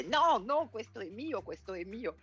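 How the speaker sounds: put-on high, stubborn little-child protest; toddler voice. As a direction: play a small child refusing to share. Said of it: "il bambino impara a dire". (voce da bambino capriccioso)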